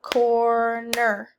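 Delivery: syllable-by-syllable clapping; clapped syllables. Shown as a demonstration. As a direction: say it in claps, up-ward. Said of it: "Clap it".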